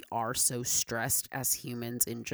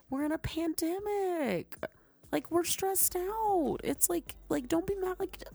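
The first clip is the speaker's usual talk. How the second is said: high sing-song. Real, not acted. Pleading about pandemic stress.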